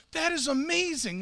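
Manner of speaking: light up with joy for them; excited praise. Retold or imitated. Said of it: "he was just so excited".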